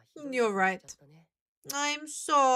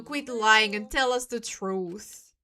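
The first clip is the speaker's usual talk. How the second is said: normal talking voice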